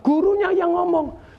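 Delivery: put-on high, drawn-out child's reply; retold child's answer. Son blaming his teacher to father, high and drawn out. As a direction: lift it high and slow, like a child explaining. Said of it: "Kemudian bilang".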